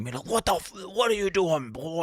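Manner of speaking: high-pitched mock outrage from a principal; imitated irate principal. Principal telling off a laughing student, mock outraged. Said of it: "he started going".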